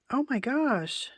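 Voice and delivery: high, slow, drawn-out oh my gosh; real delight at a pretty find; delighted gasp of wonder. Real, not acted. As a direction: marvel at it, stretching each word. Delighted at the card kit.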